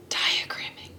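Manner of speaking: whispered, like a spooky secret; hushed teasing whisper; mock-dramatic whisper. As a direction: breathe the word as a playful threat. Teacher announces dreaded diagramming.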